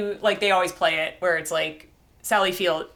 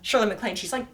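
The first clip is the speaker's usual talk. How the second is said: ordinary delivery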